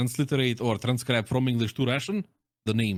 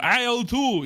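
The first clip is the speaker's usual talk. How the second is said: radio callout; 9 semitones higher, 3 dB louder, slower than usual. Pretend. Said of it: "Yeah, or".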